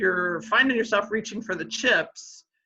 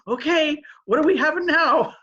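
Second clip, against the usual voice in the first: a light, higher, louder eager dog voice; voicing the dog; 7 semitones higher, 5 dB louder than usual. Owner voicing her chip-loving dog, lightly higher and louder. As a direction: put eager words in the dog's mouth, lightly. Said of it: "like, going".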